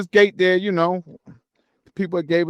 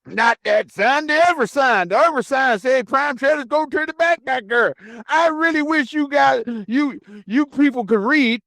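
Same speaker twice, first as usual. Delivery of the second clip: high, mocking imitation of the guard; condescending guard; 11 semitones higher than usual. Narrator voices the guard sneering that they cannot read, high and mocking. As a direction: talk down to him like the guard.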